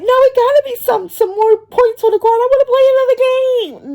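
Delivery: put-on high begging kid voice. Nephew begging for another game.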